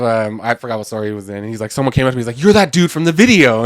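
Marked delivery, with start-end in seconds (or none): 2.4-3.6 louder, higher, drawn-out exclamation; excited recognition. Retold or imitated. Stranger spots the guy from a video, excited recognition. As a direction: call it out slowly, as if spotting someone known.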